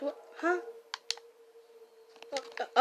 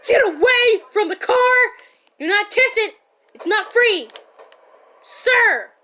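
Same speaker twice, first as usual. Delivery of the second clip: high, loud yelling; panicked shout; 4 semitones higher, 11 dB louder than usual. Acted. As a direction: shout them off the car in a panic. Yelling at someone at car.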